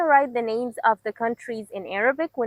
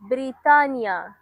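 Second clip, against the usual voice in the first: slowly; slower than usual. Shown as a demonstration. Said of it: "One more time slowly".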